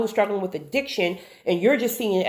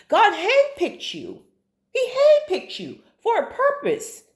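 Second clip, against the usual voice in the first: high, slow emphatic testimony; retold fervent urging, stretched out; impassioned exhortation; 11 semitones higher than usual. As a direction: press it home like a preacher, pitched high.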